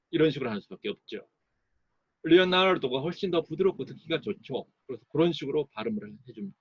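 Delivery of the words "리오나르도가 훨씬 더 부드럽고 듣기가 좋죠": ordinary delivery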